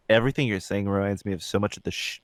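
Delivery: ordinary talk, nothing marked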